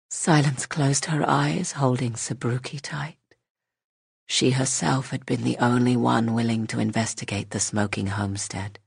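ordinary delivery

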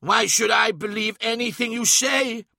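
high-pitched, forceful challenge; defiant cry. A narrator's reading. Magnus challenges the mirror's vision, crying out defiantly. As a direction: cry out against the tempter. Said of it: "he cried".

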